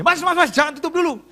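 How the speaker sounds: high, hurried-sounding call to stop; acted urgent plea. Pastor begging cafe worker to stay open, urgent. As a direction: call out before they shut, pitched high. Pleading with closing cafe staff.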